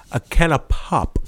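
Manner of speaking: a can of pop in a put-on British voice; lightly put-on British accent; mock British. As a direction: play a Brit ordering a drink. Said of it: "what do you think they gonna say?".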